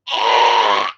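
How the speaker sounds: low, choked yelp; "Ah!" as a low, strangled cry. Retold or imitated. Retelling being choked by dad, the first take: low choked "Ah!". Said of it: "Except it was really more high-pitched like a pig".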